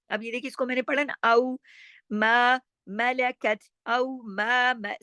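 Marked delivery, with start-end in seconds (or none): 1.2-4.9 slow औ मा मलकत with light, unheavy consonants; thin letters. Shown as a demonstration. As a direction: keep मलकत thin, stretch मा. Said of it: "सब बारीक letter है". (औ मा मलकत, बारीक हर्फ़ों से)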